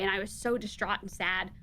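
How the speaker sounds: usual speaking voice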